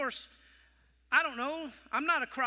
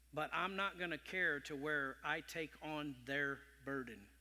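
soft pastoral talk; 6 semitones lower, 8 dB quieter than usual. Acted. Quiet pastoral teaching.

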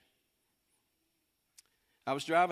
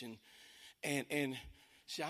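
normal talking voice

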